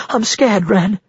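high, trembling frightened woman; fearful, pitched well up. A narrator's reading. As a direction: plead in a small scared voice. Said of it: "she said, her voice trembling".

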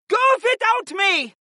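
very high, impassioned protest; defiant shout. A narrator's reading. Warrior princess to her family, shouting in protest. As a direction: shout back with fierce resolve, pitched high. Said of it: "Freya shouted once more".

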